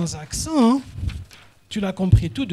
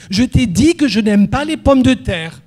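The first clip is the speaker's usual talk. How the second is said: loud from the first word, stress on dit; shouted from the start; 3 semitones higher, 10 dB louder than usual. Shown as a demonstration. Teacher demonstrating a phrase wrongly shouted. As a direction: hit dit hard and loud right away. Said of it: "tu ne cries pas au début de la phrase".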